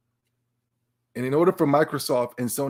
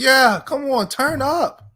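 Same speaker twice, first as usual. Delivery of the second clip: high excited call to turn up; hype; 12 semitones higher, 7 dB louder, slower than usual. Real, not acted. Streamer hyping his chat over Pokemon, excited high shout. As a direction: pump the chat up, high and loud.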